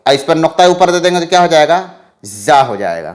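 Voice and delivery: ordinary talk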